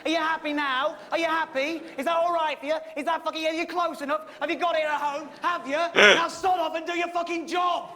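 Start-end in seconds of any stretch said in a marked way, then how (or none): 5.9-7.9 angry shout. Real, not acted. Yelling at the cameraman to leave, furious shout. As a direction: shout him off, full volume.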